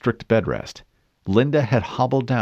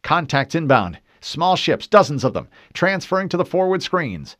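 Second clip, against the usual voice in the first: higher, louder urgent callout; urgent alert; 8 semitones higher, 3 dB louder than usual. A narrator's reading. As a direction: call the contacts out fast. Officer calls out incoming ships.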